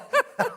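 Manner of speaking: high, loud burst of laughter. Real, not acted. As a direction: laugh at your own mistake. Laughs at own failed phrase.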